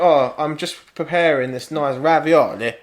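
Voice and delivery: slow, put-on Italian lilt on "ravioli"; lightly mocking a TV cook showing off; mock pretentious Italian. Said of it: "So they're like".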